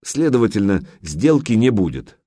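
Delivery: ordinary delivery, nothing marked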